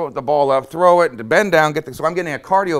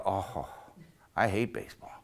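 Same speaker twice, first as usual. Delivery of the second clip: soft, miserable moan; miserable groan; 4 semitones lower, 12 dB quieter than usual. Retold or imitated. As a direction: sigh it out from the bed, sore and beaten. Lying bruised in pain on bed.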